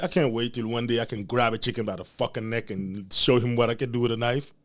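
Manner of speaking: normal talking voice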